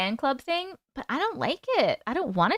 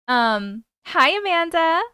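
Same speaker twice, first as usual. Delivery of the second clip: ordinary talk, nothing marked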